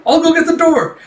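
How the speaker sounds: high-pitched, loud shout on the run; acted eager holler; eager calling out. Apprentice running to answer door.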